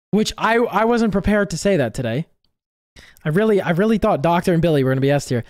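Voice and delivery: usual speaking voice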